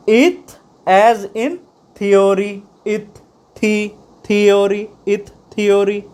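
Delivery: "Ith" and "theory" repeated slowly and deliberately; slow pronunciation drill. Shown as a demonstration. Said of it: "समझ आ रही है आपको".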